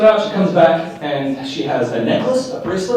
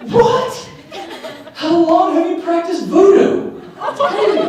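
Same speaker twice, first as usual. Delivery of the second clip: shocked falsetto disbelief; slower than usual. Retold or imitated. Stranger squealing in disbelief at his beautiful wife. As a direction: voice shoots way up in amazed disbelief.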